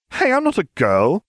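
high, loud indignant protest; shouting. A narrator's reading. Ron corrects Lupin about being a girl, shouting. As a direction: shout back, offended. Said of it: "Ron shouted at Lupin".

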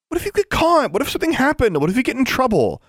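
mock hysteria. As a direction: parody the fretting friend, hammed-up panic. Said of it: "Alexandra mimicked Anna, ... in mock hysteria".

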